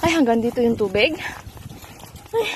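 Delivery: ordinary talk, nothing marked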